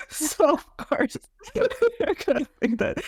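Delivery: high, giggling through the words; amused giggly aside about the heroine; laughing tease. Real, not acted. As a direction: laugh while teasing her naivety. Teasing the heroine's naive crush.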